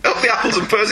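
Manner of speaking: put-on Cockney accent; mock Cockney accent. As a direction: imitate a Cockney, playfully. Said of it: "He's Cockney".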